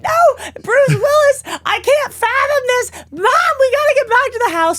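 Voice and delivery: mock cry, pitch far up; sobbing mock disbelief; retold sobbing. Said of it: "I was like".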